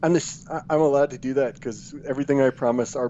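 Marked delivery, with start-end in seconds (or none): none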